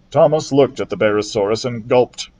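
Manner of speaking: plain reading voice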